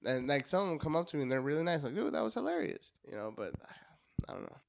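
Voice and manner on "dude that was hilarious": high-pitched gushing fan; imitated fan praise. Fan praising the comic after a show, imitated excitedly. Quoting friendly fan's praise.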